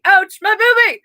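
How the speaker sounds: high-pitched exclaimed ouch; loud exclamation. Real, not acted. Reader repeats ouch about own sore chest, loud exclamation. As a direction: cry it out high, playing up the pain. Repeats the pain cry, louder.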